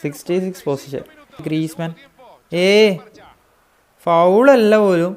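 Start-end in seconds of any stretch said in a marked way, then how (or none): 2.6-5.1 loud, slow outraged complaint; indignant protest. Real, not acted. Gamer protesting a no-foul call, slow loud indignation. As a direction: drag it out in disbelief at the ref.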